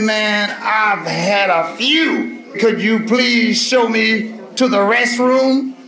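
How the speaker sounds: slow, slurred tipsy asking; drunk voice. Retold or imitated. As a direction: drag the words like you've had a few. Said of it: "He said".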